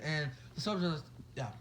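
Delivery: plain reading voice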